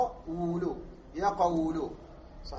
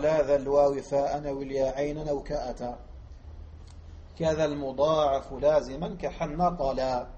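verse recitation; 3 semitones lower than usual. Shown as a demonstration. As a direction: recite the verse line as a teacher modelling it for the class. Teacher recites the Alfiya verse. (الشيخ يلقي بيت الألفية على الطلاب، إلقاء شعري موزون)

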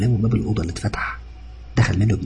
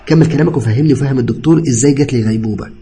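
louder, higher sarcastic challenge; angry demand; 4 semitones higher, 6 dB louder than usual. A narrator's reading. Patient confronting cousin before the doctor, angry demand. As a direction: demand an explanation, indignant.

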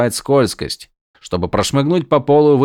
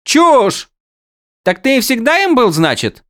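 high mocking character voice; 5 dB louder than usual. Windel mocks, far high voice.

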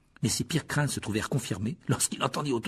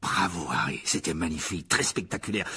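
lower-voiced praise; congratulating captain; 6 semitones lower than usual. A narrator's reading. Dubois praising his injured seeker, low congratulating voice. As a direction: give admiring praise in a lowered voice.

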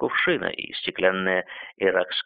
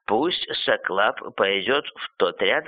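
usual speaking voice

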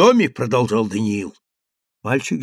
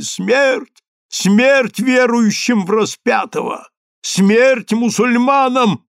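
high, slow chant-like cries; shouted war cry; 9 semitones higher, 4 dB louder, slower than usual. A narrator's reading. Rabbis threaten the unbelievers, shouted war cry. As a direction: draw out each call for death. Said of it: "кричали Даниилу раввины".

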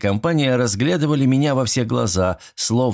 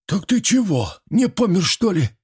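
high-voiced bewildered old villager; astonished question in a put-on high voice; exhaled astonishment; 12 semitones higher than usual. A narrator's reading. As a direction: ask it high, as if stunned he's alive. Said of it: "выдохнул Митрич".

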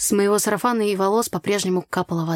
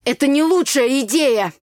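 higher, louder objection; strained protest; 7 semitones higher, 6 dB louder than usual. A narrator's reading. Brother fighting off his sister's grip, strained protest. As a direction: protest while wrestling free. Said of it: "скрючился надо мной брат, пытаясь ослабить мою хватку".

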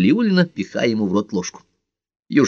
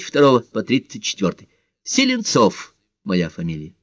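higher, lilting apologetic caller; hesitant, well-mannered stranger on the phone; polite, slightly embarrassed; 7 semitones higher than usual. A narrator's reading. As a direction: sound courteous and a touch sheepish. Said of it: "вежливый, немного смущённый голос".

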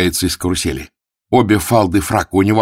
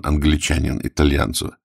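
normal talking voice

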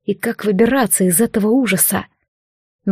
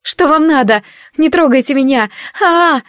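испуганный крик; 5 semitones higher than usual. A narrator's reading. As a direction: scream in fear. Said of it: "испуганный вскрик Дейзи". (испуганный вскрик)

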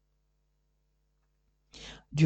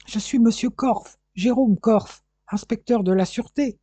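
high, urgent self-identification; raised, insistent policeman's cry; 6 semitones higher, 3 dB louder than usual. A narrator's reading. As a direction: call out your name and rank, pitched up. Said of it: "s'écria le policier".

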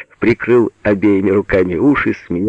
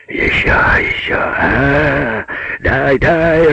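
strained, raised growl; 7 semitones higher, much slower than usual. A narrator's reading. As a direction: roar for more through the heat. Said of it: "рычал Пётр". (рычащий крик)

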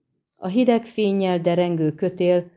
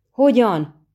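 higher, louder indignant burst; angry, choked snarl; 5 semitones higher, 6 dB louder than usual. A narrator's reading. Dangling sorceress retorting to the witcher, angry choked snarl. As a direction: throw it back with held-in anger. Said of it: "haragos, visszafojtott hördülését".

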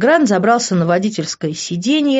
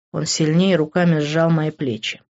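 ordinary delivery, nothing marked

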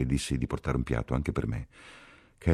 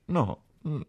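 choked tears; 10 semitones higher than usual. A narrator's reading. Ferguson sends Catherine away while crying, choked stammer. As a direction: choke on the words, breaking off and restarting.

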